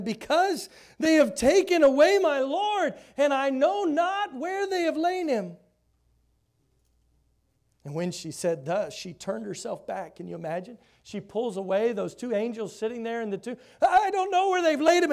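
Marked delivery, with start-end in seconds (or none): 0.0-5.6 much higher and louder, crying out; Mary's anguished reply. Acted. Mary to the angels, anguished and loud. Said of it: "She saith unto them". 13.8-15.1 much higher and louder, near wail; distraught Mary. Acted. Mary to the angels, grieving, much higher and louder.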